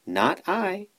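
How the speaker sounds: very high quacky reply; quacking duck. A narrator's reading. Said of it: "quacked a duck".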